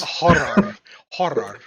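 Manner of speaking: "horror" carefully said with a full second syllable; practised OR ending. Shown as a demonstration. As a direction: practise "horror", sounding out the final OR. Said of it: "O R at the end".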